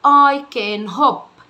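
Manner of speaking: very slow, each word apart; slow classroom drill; slow teaching read. Shown as a demonstration. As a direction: drill it slowly for a child. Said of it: "ادي انا كررتها مرتين".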